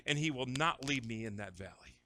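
ordinary delivery

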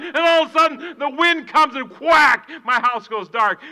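loud, high, fervent build; excited preaching. Acted. Pastor sharing his week's excitement with the church. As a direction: pour out the excitement.

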